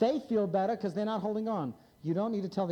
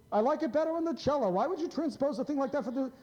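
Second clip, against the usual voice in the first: mocking critic; 6 semitones higher, faster than usual. Mocking critics of his clarinet Bach.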